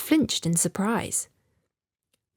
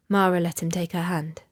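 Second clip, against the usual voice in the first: usual speaking voice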